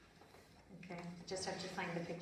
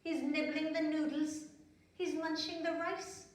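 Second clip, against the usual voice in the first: sing-song rhythmic poem, higher and louder; poem recital; 7 semitones higher, 3 dB louder than usual. Acted. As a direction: bounce the rhyme for children.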